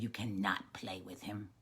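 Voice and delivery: low, quiet firm ban; firm mother. Acted. Little Frog's mother forbids the friendship, firm and low.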